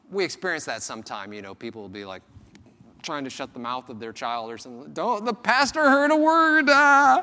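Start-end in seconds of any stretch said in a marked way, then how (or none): 4.9-7.2 high, loud mock-panicked hush; panicked parent. Parent hushing child who swore near the pastor, mock panic. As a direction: play a frantic parent hushing a kid.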